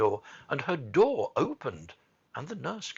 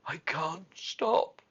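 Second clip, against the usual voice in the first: slow, higher, sobbed words; gasping and sobbing; 5 semitones higher, much slower than usual. A narrator's reading. Sick boy answering Mary, gasping and sobbing. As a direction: gasp it out helplessly. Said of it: "he gasped and sobbed".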